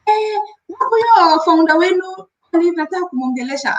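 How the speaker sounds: demanding caller, voice pitched high; imitating an annoyed customer on the phone; mock angry client. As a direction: bark for the founder like an irritated customer. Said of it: "a client called me so angry".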